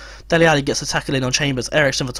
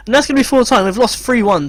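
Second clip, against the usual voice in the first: high, loud full-time call; loud dismayed call; exasperated announcement; 7 semitones higher, 4 dB louder than usual. Real, not acted. Final whistle exasperation.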